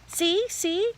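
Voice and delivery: put-on high, little-kid voice; excited toddler. A narrator's reading. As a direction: show off like a small child. Toddler showing off to brother.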